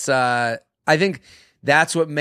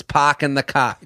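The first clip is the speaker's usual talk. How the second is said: R-dropping Boston accent, briefly put on; mock Boston accent. Mocking locals after Good Will Hunting, mock Boston accent. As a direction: imitate a newly acquired Boston accent. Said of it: "now everyone all of a sudden".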